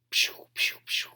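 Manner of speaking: pew pew whispered; whispered firework pews. Acted. Imitates fireworks while reading.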